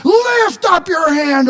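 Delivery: very high, loud rallying cry; soaring battle summons; triumphant call. Acted. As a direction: urge God into the fight. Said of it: "a triumphant call to go into battle".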